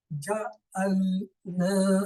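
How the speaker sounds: جعلنا said very slowly, the naa held long; very slow drawn out. Shown as a demonstration. Teacher slowly stretching جعلنا for the class. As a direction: draw out جعلنا syllable by syllable. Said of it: "اس نون کو اتنا کھینچ کے".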